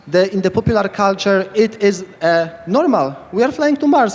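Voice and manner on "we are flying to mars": much higher, eager proclamation; excited. Real, not acted. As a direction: announce it like a thrilling revelation. Repeats claim, pitch jumps up.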